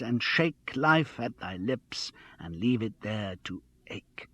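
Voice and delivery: slow, measured poetry recitation; recited verse. A narrator's reading. Mark quoting Swinburne to Charles, slow recitation. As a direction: intone the verse slowly, letting it die away. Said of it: "Mark recited".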